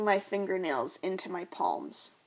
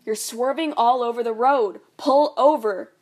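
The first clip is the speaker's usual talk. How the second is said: loud, frantic yell, pitch rising; mother yelling at her husband to pull over; yelling mom; 3 semitones higher, 11 dB louder than usual. Acted. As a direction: shout him down from the passenger seat. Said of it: "My mom yells back at him".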